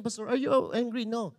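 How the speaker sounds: ordinary talk, nothing marked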